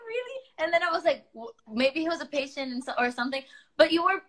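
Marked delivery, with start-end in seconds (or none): 0.1-0.4 high, disbelieving "Really?"; retold surprise. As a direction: recall your shock at the cast list.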